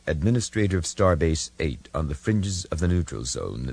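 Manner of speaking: ordinary delivery, nothing marked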